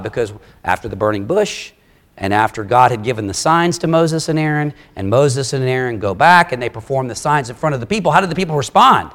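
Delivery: ordinary talk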